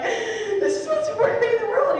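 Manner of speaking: overflowing with new zeal; gushing excitement. Retold or imitated. Imitating her own zeal to parents.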